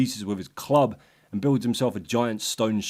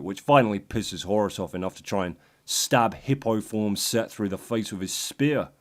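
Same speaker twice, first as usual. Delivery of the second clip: ordinary delivery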